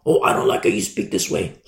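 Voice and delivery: low, whispered mocking voice; mock complainer. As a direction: sneer it like a petty critic. Mock critic of preacher.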